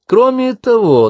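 high, drawn-out, pointedly loud announcement; deliberately loud. A narrator's reading. Miyuki teasing Karen for Jack to hear, deliberately loud. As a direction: announce it loudly so others overhear. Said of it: "нарочито громко сообщила Миюки".

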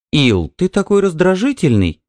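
high-pitched wifely complaint; wife's protest. A narrator's reading. Wife protests at husband's temper. (высокий голос жены, с упрёком)

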